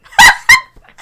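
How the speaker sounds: shrill burst of helpless laughter; cracking up at the memory; high shrieking laugh. Real, not acted.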